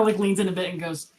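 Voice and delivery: normal talking voice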